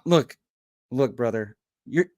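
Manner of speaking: ordinary talk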